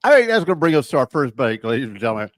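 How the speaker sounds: upbeat announcer read into the break; radio host break announcement. Acted. Host announcing a break to listeners, announcer read.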